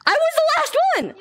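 high indignant cry; 1 indignant shriek. Real, not acted. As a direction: shriek it in outrage. Protesting being tagged last.

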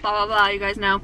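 normal talking voice

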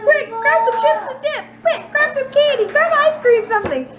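very high, urgent squeaky pleading; frantic body voice. Pretend. Mom voicing her craving body, frantic and squeaky high. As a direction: beg for junk food like a panicking gremlin. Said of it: "started freaking out".

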